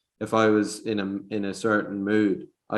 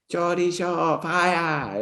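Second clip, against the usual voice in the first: higher-pitched Geordie accent; Geordie imitation; 5 semitones higher, 3 dB quieter, much slower than usual. Shown as a demonstration. Host imitating Geordie Shore cast, Geordie accent. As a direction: call it out as a Geordie. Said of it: "You know, like that".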